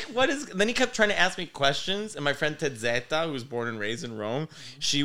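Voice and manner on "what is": confused what. Retold or imitated. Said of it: "I'm like".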